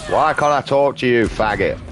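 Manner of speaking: real rage-yell at a game character; angry yell at game. Real, not acted. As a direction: bark at the screen in anger.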